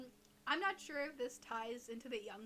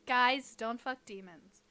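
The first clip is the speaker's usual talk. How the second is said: mock PSA; 10 dB louder, slower than usual. Mock public service announcement.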